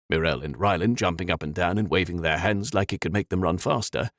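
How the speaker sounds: usual speaking voice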